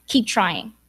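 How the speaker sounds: usual speaking voice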